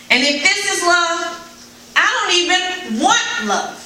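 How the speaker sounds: high, slow, aching protest; desperate plea. Retold or imitated. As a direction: beg through the hurt, then fade away. Retold pleading with abuser.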